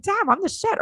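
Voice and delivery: high, cocky self-praise; playful boast, voice jumped high; mock bragging. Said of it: "you can reread 'em and be like".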